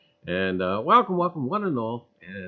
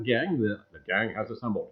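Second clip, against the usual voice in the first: usual speaking voice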